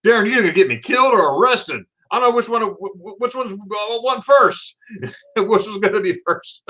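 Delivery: high, stammering mock panic breaking into a laugh; imitated panicked buddy. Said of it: "he was having a heart attack".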